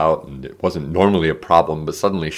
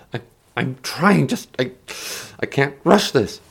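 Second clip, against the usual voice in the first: higher, halting, strained plea; flustered protest; 6 semitones higher than usual. A narrator's reading. Martin pressed by Dole to read, flustered. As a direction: stammer a mild protest.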